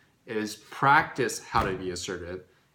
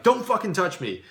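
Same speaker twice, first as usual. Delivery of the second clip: higher, louder angry warning; severe angry snap; 10 semitones higher, 6 dB louder than usual. Shown as a demonstration. Demo of the overly harsh reply to someone poking, angry snap. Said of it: "not be, like, too severe".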